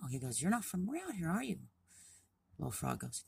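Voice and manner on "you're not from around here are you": higher-pitched animal character voice; curious storybook beaver. Acted. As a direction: question a newcomer in a raised storybook voice. Beaver questions the visiting frog.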